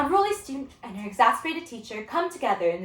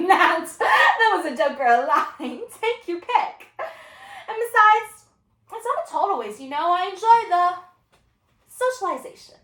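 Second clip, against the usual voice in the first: high, laughing, flippant deflection; breezy student excuse, pitched up with stretched words; unruly student; 9 semitones higher, 6 dB louder than usual. Acted. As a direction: laugh off being caught out.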